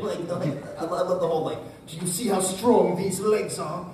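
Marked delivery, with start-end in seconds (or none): 1.9-3.8 loud, higher showy hero voice; Joseph. Acted. Actor quotes his anime hero's plan, loud heroic voice. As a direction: announce it boldly, like a cocky cartoon hero. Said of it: "And Joseph's like".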